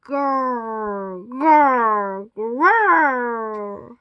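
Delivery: low, drawn-out growls; long rolling grrr, building slightly; monster growl. A narrator's reading. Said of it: "screechiest sound of all".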